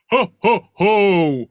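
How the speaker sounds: a hint of a Santa "ho ho ho"; Santa Claus laugh. Shown as a demonstration. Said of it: "that's gonna be low".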